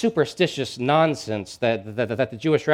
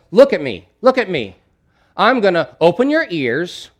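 loud, raised voice with emphatic phrases; addressing a deaf man; 4 semitones higher, 7 dB louder than usual. Acted. Preacher plays Jesus signing to a deaf man, loud and emphatic. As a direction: make each phrase big and emphatic. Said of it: "communicate that to a deaf man".

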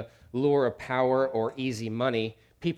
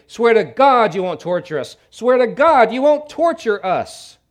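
loud high pleading scream; screaming plea; 10 semitones higher, 13 dB louder than usual. Acted. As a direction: demons screaming at Jesus for mercy. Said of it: "And they scream at Jesus".

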